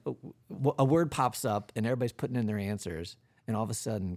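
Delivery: normal talking voice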